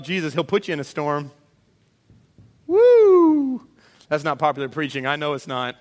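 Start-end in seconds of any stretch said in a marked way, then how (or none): 2.7-3.7 loud, high preacher's whoop; real whoop of excitement at the pulpit; excited whoop. Real, not acted. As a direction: let out a big celebratory woo. Preacher whoops at own point.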